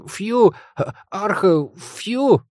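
very high creature whimper; plaintive whining. A narrator's reading. Little monster scolded by mistress, pitiful whine. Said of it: "жалобно заскулил недочервяк".

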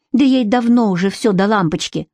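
usual speaking voice

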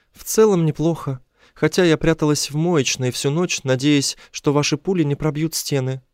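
high female character voice; high-pitched woman confiding; captive woman. A narrator's reading. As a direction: recount it in a high, light woman's voice. Woman captive tells her night.

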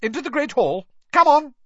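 high, loud brisk command; sharp. Acted. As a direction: herd them in briskly. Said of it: "said Professor McGonagall sharply".

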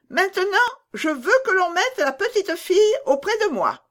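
put-on high, loud, demanding child voice; a bossy little girl insisting on her toy; spoiled robber girl. A narrator's reading. Robber girl claims Gerda from her mother, high demanding child voice. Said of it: "dit-elle".

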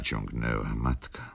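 ordinary delivery

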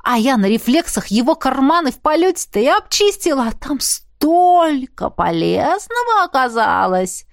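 high, animated bragging; delighted pickpocket's brag; gleeful boasting. A narrator's reading. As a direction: revel in it, stretching the second half. Din boasts of picking pockets.

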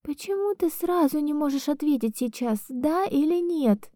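high, tearful insisting; hysterical. Acted. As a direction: demand an answer in hysterics. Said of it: "в состоянии истерики".

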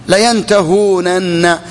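layantahūnanna drawn out syllable by syllable; full underlying form. Shown as a demonstration. Teacher gives students the verb's origin, full form sounded slowly. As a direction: stretch the long ū and sound both nuns, very slowly. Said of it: "لأننا حذفنا الواو".

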